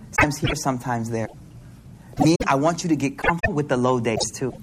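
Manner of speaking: normal talking voice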